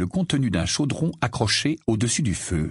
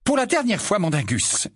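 higher, scolding mother; exasperated, at the end of patience; exasperated exclamation; 11 semitones higher than usual. Acted. Mrs Wesley scolding Mondingus for smoking, exasperated. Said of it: "s'exclama missus Wesley".

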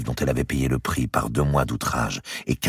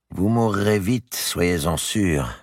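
slow, higher, ominous; grave warning; 7 semitones higher, much slower than usual. A narrator's reading. Madame de Bouville warning Marie, slow grave voice. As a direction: warn her, slowly.

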